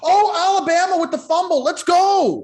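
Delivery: high, loud, fast cheer; excited shout. Real, not acted. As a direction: yell it out at the fumble. Reacting live to a fumble.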